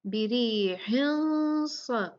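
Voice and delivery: बिरीहिन with a thick nasal into a heavy स, slow; heavy ikhfa. Shown as a demonstration. Teacher shows heavy ikhfa slowly. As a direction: hide the n thickly before स. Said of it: "स्वाद भी heavy letters में से है".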